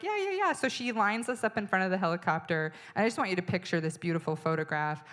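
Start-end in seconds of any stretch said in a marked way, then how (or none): 0.0-0.5 high, chirpy, eager yeses; eager agreement. Retold or imitated. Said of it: "And she's like".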